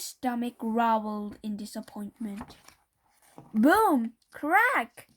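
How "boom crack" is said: ordinary delivery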